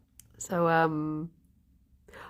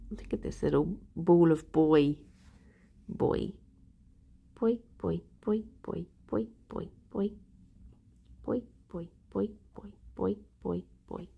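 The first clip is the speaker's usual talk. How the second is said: cooing at a pet; 3 semitones lower, 7 dB quieter than usual. Real, not acted. Streamer cooing softly at a cute pet.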